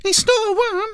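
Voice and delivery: very high, loud tiny-badger voice; small badger protesting, very high and loud; squeaky little badger. Small badger Stuart speaks.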